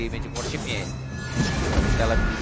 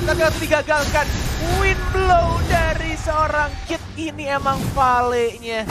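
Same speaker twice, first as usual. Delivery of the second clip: loud, high shoutcast call; excited shout; 10 semitones higher, 3 dB louder, slower than usual. Acted. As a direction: yell the highlight. Caster shouting the play.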